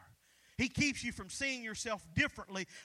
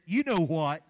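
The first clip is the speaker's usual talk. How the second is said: lower, louder knowing parrot jab; mock parrot taunting the man; sly parrot voice; 7 semitones lower, 10 dB louder than usual. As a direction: drop it low and loud like a smug parrot. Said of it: "The parrot said".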